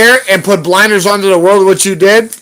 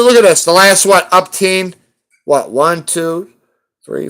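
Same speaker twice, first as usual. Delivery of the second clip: ordinary talk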